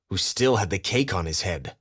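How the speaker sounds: usual speaking voice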